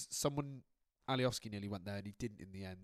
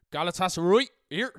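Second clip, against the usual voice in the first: loud, high Swindon accent mimic; mock Swindon accent; 6 semitones higher, 12 dB louder than usual. Teasing his co-host by mimicking his Swindon accent. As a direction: shout it back in a Swindon accent. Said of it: "in a Swindon accent".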